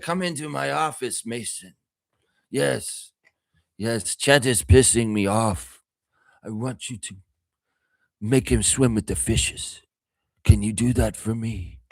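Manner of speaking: lightly voiced mafia boss giving an order; Godfather Brando voice. Wemby as the Don ordering Mason, light Godfather voice. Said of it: "Gonna do Marlon Brando".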